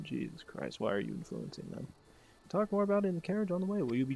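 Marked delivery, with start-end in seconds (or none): 0.0-1.9 exasperated companion. Retold or imitated. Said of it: "He's just going".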